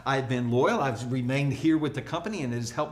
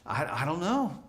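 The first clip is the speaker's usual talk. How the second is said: doubtful. Shown as a demonstration. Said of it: "through the lens of".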